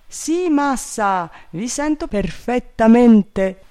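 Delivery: high, drawn-out servant reply; high-pitched dutiful answer; calling servant. A narrator's reading. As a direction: call back down from the treetop.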